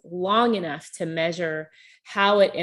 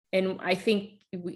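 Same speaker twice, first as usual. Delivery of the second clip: usual speaking voice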